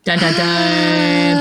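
slow, drawn-out suspense sting; dramatic sting. Pretend. Reader closing book one, mock-dramatic drawn-out sting. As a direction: intone a mock cliffhanger sting, slowly.

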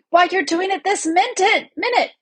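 high-pitched exasperated bellow. A narrator's reading. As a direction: call out the accusation, pitch right up. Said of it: "bellowed Trumpkin".

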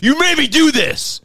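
loud high slow accusing yell; abuser yelling. Retold or imitated. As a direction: blame them, shouting. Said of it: "in an abusive relationship".

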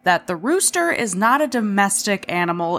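normal talking voice

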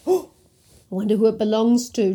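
ordinary delivery

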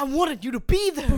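loud whiny pleading to dad; mock son wailing at his dad; pleading shout. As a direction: wail it at your father.

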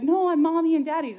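high, loud imitation of a child; little niece protesting. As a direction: protest like an indignant little kid. Voices young niece objecting.

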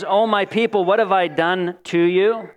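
ordinary talk, nothing marked

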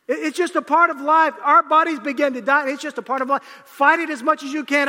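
loud, high comic preaching rant; preaching at full force. Acted. Pastor riffing to the congregation, loud and high. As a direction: belt it out like a pastor venting about aches.

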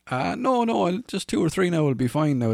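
nervously declining another beer; hasty refusal. Retold or imitated. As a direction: wave it off, voice jumping up. Himself declining another drink.

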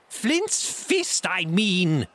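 very high, exasperated pirate yell; angry cry. A narrator's reading. Blind man correcting his man, impatient cry. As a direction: snap it out in a high shout. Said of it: "he cried".

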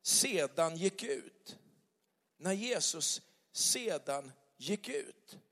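"sedan gick ut" drawn out slowly, word by word; slow emphasis. Shown as a demonstration. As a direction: stretch every word of "när Jesus sedan gick ut". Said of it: "upprepar bibeltexten". (långsamt och eftertryckligt "sedan gick ut")

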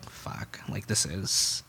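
very soft, sinking murmur; hushed dread. Retold or imitated. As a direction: curse under your breath, voice dropping away. Dreading news walking into ICU.

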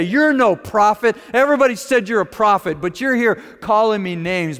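loud, high acted outrage; indignant retort. Insulted woman turning on the prophet, indignant retort. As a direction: fire it back in mock indignation. Imagined woman angrily walking away.

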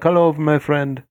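put-on slow, high Indian accent greeting; a slow friendly Indian accent greeting. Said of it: "it's not like Indian, like".

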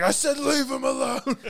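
very high mock-heroic yell; imitated angry yell. Man defending his friend from a zombie, imitated high yell. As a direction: cry it out high, as a threat.

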